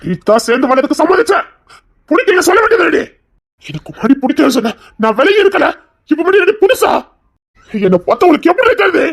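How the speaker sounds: high, loud pleading yell; desperate shouting. Acted. Husband shouting at his wife behind the door. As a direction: yell your hurt at her. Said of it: "நீ எவ்வளவு கத்துனாலும் அவ வரமாட்டா".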